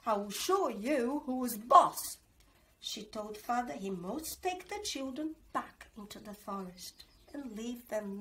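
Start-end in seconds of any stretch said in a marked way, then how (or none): 0.1-2.2 a mild stern stepmother threat; lightly voiced bossy stepmother; cross stepmother. A narrator's reading. Stepmother scolding the children, mildly stern. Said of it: "she said".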